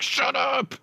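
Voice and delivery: sudden high, loud "shut up"; mock outburst. Retelling his reply to the gun salesman, a high, loud mock shout. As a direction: yell it in playful disbelief. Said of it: "I was like".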